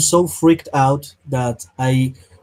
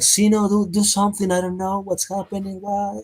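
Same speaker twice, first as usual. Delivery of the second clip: high-pitched panicky begging, fading softer; scared plea; 6 semitones higher, 4 dB quieter than usual. Acted. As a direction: act out a frightened cry for help, trailing away. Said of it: "I'm so scared".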